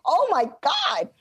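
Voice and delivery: stunned realization. Retold or imitated. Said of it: "And so I was like".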